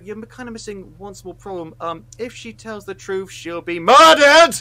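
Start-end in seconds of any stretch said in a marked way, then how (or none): 3.3-4.6 loud, high, drawn-out outburst; exasperated shout. Real, not acted. Host pointing out the film's one small problem, exasperated shout. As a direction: shout the last word in outrage. Outraged punchline of the rant.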